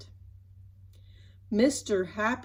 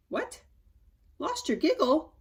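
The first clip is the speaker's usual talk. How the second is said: befuddled; 7 semitones higher, 3 dB louder than usual. Acted. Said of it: "he asked befuddled".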